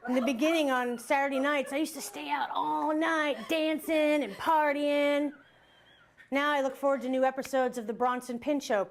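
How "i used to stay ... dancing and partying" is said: slow, drawn-out, higher-pitched relish; savoured boast. Acted. Comic recalling Saturday nights out, drawn-out boast. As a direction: stretch out the wild nights. Savouring her wild past.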